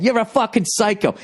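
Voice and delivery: high-pitched insistent accusation; re-enacted road-rage shout; yelling. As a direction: hurl it back, pitch way up. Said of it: "I'm yelling at the guy".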